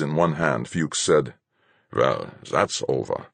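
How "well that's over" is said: calm captain. Acted. Captain's calm, cold remark.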